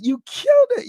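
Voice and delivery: hype-man shout; excited high shout. Real, not acted. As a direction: yell the praise up high. Praising a viewer's weight loss.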